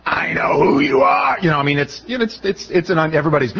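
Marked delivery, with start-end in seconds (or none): 0.1-1.4 slow, eerie horror-movie demon voice; Shining horror voice. Pretend. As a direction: growl it like a possessed man in a horror film. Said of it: "your best, you know, The Shining voice".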